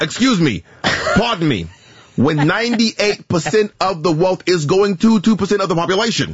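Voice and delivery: emphatic mock-senator speech; hammed-up politician impression; bad Bernie Sanders. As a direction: badly imitate a senator on the stump. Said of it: "But I have a really bad".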